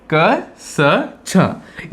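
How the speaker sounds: "क, स, छ" as three short sounds, kuh, suh, chhuh; separate letter sounds. Shown as a demonstration. Teacher recapping the three sounds of C, separate letter sounds. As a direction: sound out "क, स, छ" one by one with pauses. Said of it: "इन तीन तरह से हम यहाँ पर".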